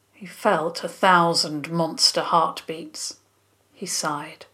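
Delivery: ordinary delivery, nothing marked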